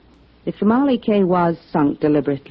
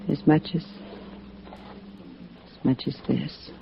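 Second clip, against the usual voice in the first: intimate hush; 10 dB quieter than usual. Acted. Ellen moves closer, flirting.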